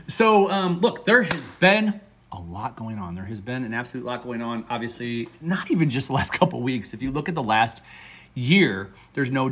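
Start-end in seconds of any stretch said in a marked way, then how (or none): none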